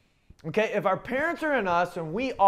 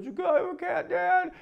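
put-on high, pleading little-kid voice; 10 semitones higher than usual. Kids begging their dad for a cat, higher pleading voice. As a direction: beg Dad sweetly like a child. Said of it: "came to me with a little frowny face".

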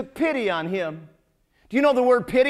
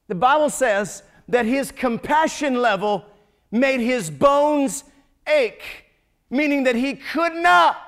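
building preaching; 3 semitones higher, 4 dB louder, slower than usual. Acted.

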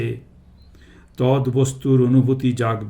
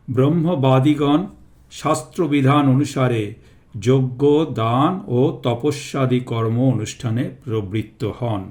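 ordinary delivery

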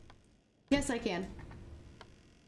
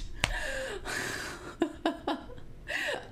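high, frightened laughing fit; long, high nervous laughter; 13 semitones higher than usual. Real, not acted. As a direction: laugh helplessly from fear. Nervous laughter in horror game.

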